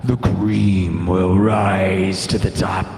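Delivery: low, slow, growly-voiced Macho Man impression. Hyping himself as the Macho Man, low and slow. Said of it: "I'm the Macho Man".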